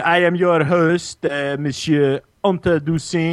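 hammy French show host; French accent. Acted. Host introduces himself, put-on French accent. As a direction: introduce yourself in mock French.

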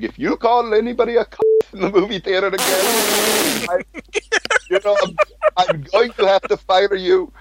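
high-pitched put-on boss voice; retold boss's reprimand. Theater owner warning his manager, in a high mocking imitation. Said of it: "And he was like".

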